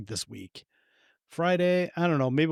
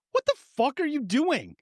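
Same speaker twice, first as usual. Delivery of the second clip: high-pitched mock outrage; indignant, pitched well up; indignant outburst; 11 semitones higher than usual. Host outraged at a charity plea, mock indignant. Acted indignant reply to fundraiser.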